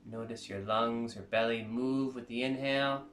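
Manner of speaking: soft, calm meditation guide voice; guided meditation. Acted.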